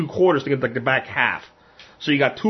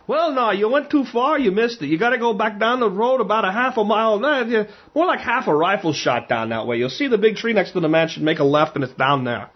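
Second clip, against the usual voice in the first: put-on high-pitched Pennsylvania Dutch accent, easing down; Pennsylvania Dutch farmer; 6 semitones higher than usual. Dutchman directs a lost hunter to the creek, Pennsylvania Dutch accent. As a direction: imitate a Pennsylvania Dutch local giving directions. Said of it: "Like, that's how these guys are".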